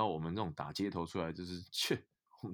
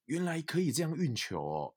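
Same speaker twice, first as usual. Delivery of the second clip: higher, awed realization; wide-eyed wonder; 10 semitones higher, 4 dB louder than usual. Retold or imitated. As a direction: marvel at the discovery. Retelling his amazement at the moves.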